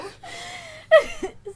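high, loud helpless laughter; burst of laughter. Real, not acted. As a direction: crack up at the absurd line.

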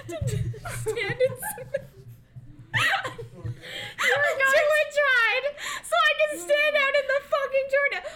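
high, helpless laughter through the words; real laughing fit, voice squeaking upward. Real, not acted. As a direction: struggle to get the story out while cracking up. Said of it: "you need to say it without laughing".

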